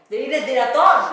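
incredulous outcry; indignant disbelief. Acted. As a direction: protest in exaggerated disbelief. Mock outrage at Finland leading odds.

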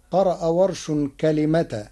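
ordinary talk, nothing marked

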